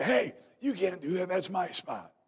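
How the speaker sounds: loud, high protest over a parking spot; an old lady yelling at a kid who stole her spot; indignant old lady. Retold or imitated. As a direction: squawk it like an offended lady. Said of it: "She up there and said".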